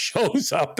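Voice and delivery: normal talking voice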